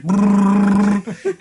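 loud lip-buzzing motorboat; imitated sputtering motor sound; motorboat noise. Imitating face falling into breasts.

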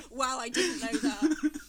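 loud, slow, laughing sarcasm; hammed-up fake astonishment; mock amazement. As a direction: feign a huge revelation while laughing. Mock reaction to British comment.

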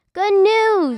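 loud, joyful call of good news; excited announcement. Acted. Shepherds calling out to the townspeople, excited loud announcement. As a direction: shout it out to the whole town.

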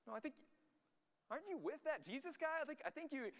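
very high mock girl voice; another girl. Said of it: "And another girl".

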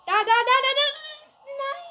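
very loud, high frantic yells; shouting. Real, not acted. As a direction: scream as he gets away. Last chance to catch him.

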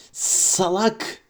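high hissed insult; Gollum hiss. Acted. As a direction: spit the insult like a creature. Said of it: "diye tısladı Gollum".

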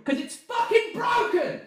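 high, exasperated shout; tantrum. Real, not acted. Ranting about the broken old phone, tantrum shout. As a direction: burst into a shrieked complaint. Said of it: "Tantrum over".

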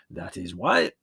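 put-on warm old peasant shepherd, proud of his trick; old Yanni voice. A narrator's reading. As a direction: voice the old shepherd showing the boy. Yanni draws the moral.

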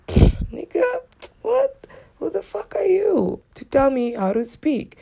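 very high-pitched, scoffing disbelief; scoff, then challenge in a high voice; high, offended comeback; incredulous indignation. Acted. Indignant retort to the critic.

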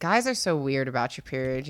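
ordinary talk

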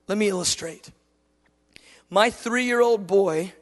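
usual speaking voice

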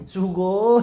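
laughing high anime-fan squeal; excited anime fan. Pretend. Joking anime exclamation.

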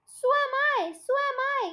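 high-pitched anxious cry; storybook wail without extra volume; acted crying out. A narrator's reading. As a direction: cry it out high, in mild panic. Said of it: "cried Mister Twit".

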